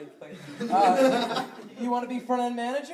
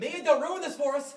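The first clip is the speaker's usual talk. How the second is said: loud scolding; 5 semitones higher, 5 dB louder than usual. Acted. Coworker scolding Mia.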